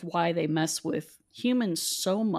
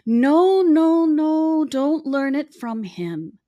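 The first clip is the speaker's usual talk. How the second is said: much higher, louder mock voice of his wife; imitating the wife; 10 semitones higher, 7 dB louder, much slower than usual. The wife cutting in so she won't learn the spices from him, in a high loud mock voice. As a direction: playfully put on the wife's high, emphatic voice. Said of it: "and she's like".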